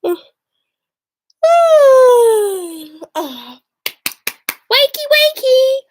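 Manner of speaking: high, loud sing-song wake-up call; stepmother calling. Acted. Stepmother rousing the children, loud and high. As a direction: chirp it out falsely sweet.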